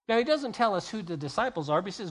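usual speaking voice

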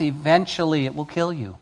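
ordinary talk

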